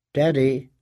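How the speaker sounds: usual speaking voice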